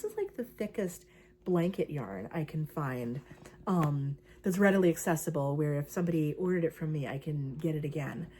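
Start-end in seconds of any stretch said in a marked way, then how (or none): none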